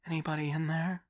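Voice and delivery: put-on high, loud young woman's voice; young woman calling out. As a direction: call through the grill like a young woman. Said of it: "a young woman's voice asked".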